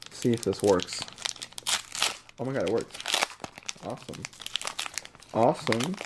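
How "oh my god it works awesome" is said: usual speaking voice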